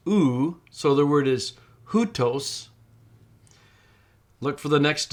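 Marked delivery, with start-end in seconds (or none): 0.1-0.6 oo said slowly and drawn out; pronounced demo. Shown as a demonstration. Teacher models the diphthong sound oo for students. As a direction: model the vowel oo clearly and slowly. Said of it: "that's pronounced".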